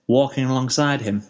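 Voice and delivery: ordinary delivery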